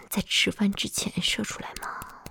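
ordinary talk, nothing marked

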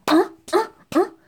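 loud, high sex moans. Acted. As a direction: let out rising moans. Woman moaning during sex. (女人高声呻吟)

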